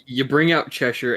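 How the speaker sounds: ordinary talk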